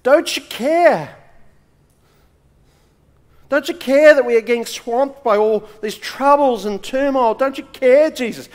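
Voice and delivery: a believer's anguished cry to Jesus, loud and high. Retold or imitated. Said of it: "you've cried out to Jesus".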